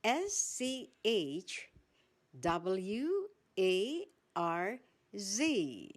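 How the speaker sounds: letters "S C H W A R Z" said slowly one by one; spelled out slowly. Shown as a demonstration. Teacher spelling schwarz slowly for the listeners. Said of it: "The spelling?".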